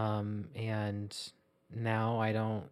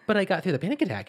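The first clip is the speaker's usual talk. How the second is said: hurried protest; 8 semitones higher, 8 dB louder, much faster than usual. Retold or imitated. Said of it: "And I was like".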